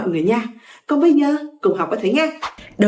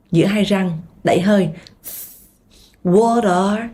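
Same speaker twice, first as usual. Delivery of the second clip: ordinary talk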